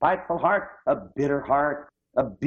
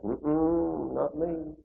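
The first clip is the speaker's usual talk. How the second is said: shy refusal; 7 dB quieter, much slower than usual. Retold or imitated. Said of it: "pull back".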